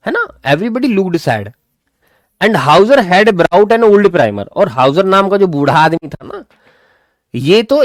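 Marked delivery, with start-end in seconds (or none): 2.4-4.5 slow, deliberate textbook reading; slow reading aloud. Shown as a demonstration. Teacher reading the story slowly to students. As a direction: read each word out slowly for the class.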